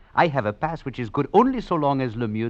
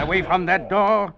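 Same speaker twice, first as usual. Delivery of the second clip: menacing command; 10 semitones higher, 5 dB louder than usual. Acted. Threatening with a knife.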